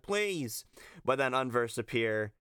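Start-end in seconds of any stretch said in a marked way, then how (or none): 0.0-0.6 mild mock plea, pitched up; a quick imitated beg; Zack. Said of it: "is like".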